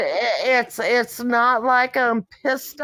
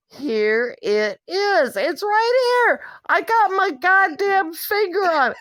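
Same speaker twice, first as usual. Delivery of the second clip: exasperated pointing; 7 semitones higher than usual. Retold or imitated. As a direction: pitch jumps higher, pressing and annoyed. Showing man the spot, exasperated.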